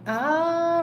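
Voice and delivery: usual speaking voice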